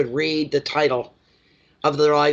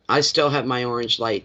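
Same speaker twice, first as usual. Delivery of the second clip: ordinary talk, nothing marked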